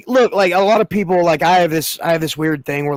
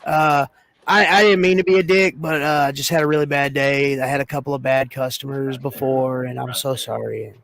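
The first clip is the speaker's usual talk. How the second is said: mock abject apology. Worker apologising after a bad day, mock grovelling. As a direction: act out a grovelling apology that trails away.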